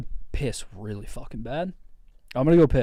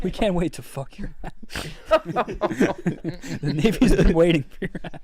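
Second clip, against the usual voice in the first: robot voice; 5 semitones higher than usual. Pretend. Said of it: "robots don't talk like that".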